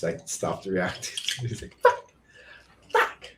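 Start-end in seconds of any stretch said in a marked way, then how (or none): 1.8-3.3 short, separated mimicked kid bursts; imitating a repeating kid. Dad imitating son repeating his swear, isolated bursts. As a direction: parrot the word like a kid, with gaps. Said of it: "he just, like, kept saying it".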